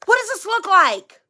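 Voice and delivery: high-pitched imitation of a fussy husband; mock fretting. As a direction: pester her for a verdict. Acted son asks about outfit.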